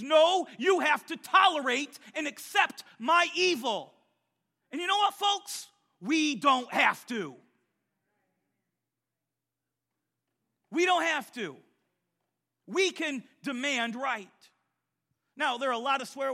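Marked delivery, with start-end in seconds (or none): 0.0-3.9 shouted mock demand, slow; sneering voice of the world; mock worldly voice. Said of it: "It says". 4.7-14.5 loud, high preaching climax; rally the congregation; fiery preaching. Acted. Preaching at full force.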